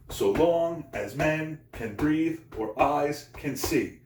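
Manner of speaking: slow da-DUM swing: "so LONG as MEN can BREATHE or EYES can SEE"; iambic heartbeat stress. Shown as a demonstration. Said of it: "It's just like a heartbeat".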